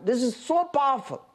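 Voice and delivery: normal talking voice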